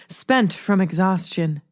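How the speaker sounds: ordinary delivery, nothing marked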